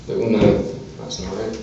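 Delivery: ordinary talk, nothing marked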